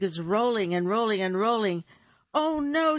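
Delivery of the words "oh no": high, loud cry of alarm; high panicked shout. Acted. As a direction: yell it in a panic. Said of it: "shouted Bear".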